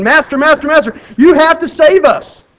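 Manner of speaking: high, loud desperate cries for help; frightened disciples screaming for their master; panicked screaming. Retold or imitated. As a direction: scream it like you're scared to death. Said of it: "come down screaming".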